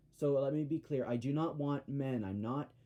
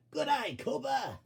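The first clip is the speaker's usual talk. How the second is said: much higher, slow put-on call; quoting Max; 10 semitones higher than usual. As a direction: cheer it out in a silly high voice.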